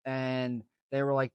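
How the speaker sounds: ordinary talk, nothing marked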